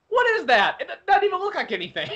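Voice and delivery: high-pitched cartoon impression, quickening at the end; Chef. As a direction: mimic a baffled cartoon man way up high. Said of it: "when Chef first saw that".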